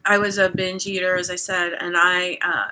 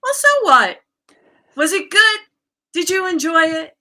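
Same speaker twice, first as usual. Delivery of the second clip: high, louder imitation of a coach; coach's voice; 8 semitones higher, 7 dB louder than usual. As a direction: brush off the guilt in her high, carefree voice. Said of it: "I started hearing her voice saying".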